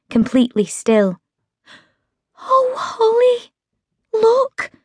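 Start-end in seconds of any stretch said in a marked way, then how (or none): 2.4-4.7 much higher, slow, breathed in awe; breathless wonder. A narrator's reading. Meg showing Holly the advert, breathless. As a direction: breathe it out, amazed. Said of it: "she breathed".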